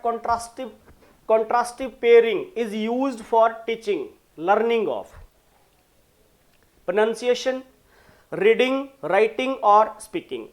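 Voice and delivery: loud, drawn-out question read; slow emphatic reading. Acted. Teacher reading the last quiz question, slow and loud. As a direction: read each word out for the class. Reads question slowly and loudly.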